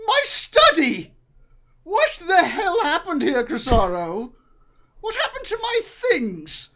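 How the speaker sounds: very high, loud shriek of outrage; loud, high-pitched indignant wife; outraged lady. Acted. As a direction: cry out in horror at a ransacked room. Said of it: "and she says".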